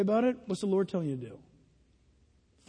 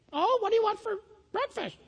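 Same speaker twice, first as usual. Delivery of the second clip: mocking parent voice, much higher; parroting parent; 9 semitones higher than usual. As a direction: parrot a parent's question in a high mock voice.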